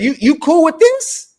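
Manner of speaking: high, slow mocking "You, you cool with this?"; needling the crew in a high, slow mock voice. Mocking teammates in debate.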